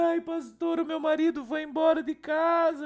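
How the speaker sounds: high, tearful mock plea; imitating weeping woman. Abandoned wife begging the pastor for help, high tearful plea. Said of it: "E ela falou assim".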